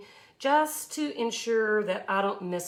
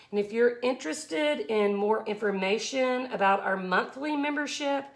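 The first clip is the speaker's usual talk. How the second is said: ordinary talk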